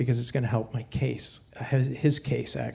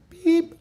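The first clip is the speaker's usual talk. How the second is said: machine beep; 12 semitones higher than usual. Retold or imitated. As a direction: squeak it out like the machine. Imitating answering machine beep.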